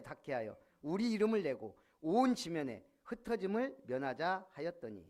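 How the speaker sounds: loud, steady Bible reading; scripture reading. Acted. Preacher reading Genesis to the church, loud and measured. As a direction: proclaim the verse to the congregation.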